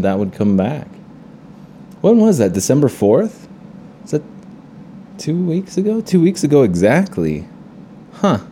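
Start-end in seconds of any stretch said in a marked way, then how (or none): 2.0-8.4 surprised. Real, not acted. Realizing how long ago.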